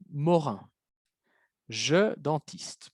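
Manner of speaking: slow, spaced "je dentiste"; slow reading. Shown as a demonstration. Teacher reading the sentence aloud to students, slow. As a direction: read "je dentiste" slowly and clearly. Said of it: "teacher reading phrase slowly".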